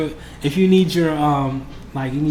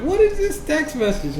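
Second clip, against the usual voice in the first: surprised; 13 semitones higher than usual. Retold or imitated. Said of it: "I'm like".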